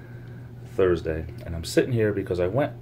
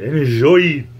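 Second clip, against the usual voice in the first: put-on louder crooning French accent; 7 semitones higher, 9 dB louder, slower than usual. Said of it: "I should do a French accent more often".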